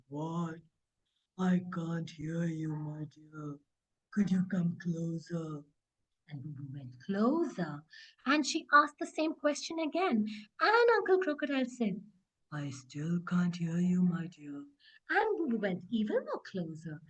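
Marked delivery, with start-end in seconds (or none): 0.1-5.6 low, slow, drawn-out crocodile voice. Acted. Uncle Crocodile coaxing Boo Boo closer, low slow voice. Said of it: "slowly opened and said". 12.5-14.6 low, slow, drawn-out crocodile voice. Acted. Uncle Crocodile coaxing Boo Boo closer, low slow voice.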